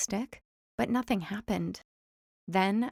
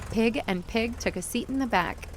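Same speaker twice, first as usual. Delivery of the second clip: ordinary delivery, nothing marked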